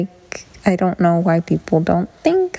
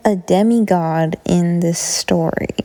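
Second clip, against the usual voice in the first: ordinary talk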